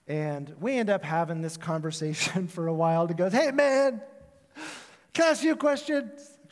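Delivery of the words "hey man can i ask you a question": high, loud stoner voice; stoned caller. Retold or imitated. Pastor imitating the caller asking him a question. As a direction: ask it like a dazed, friendly stoner.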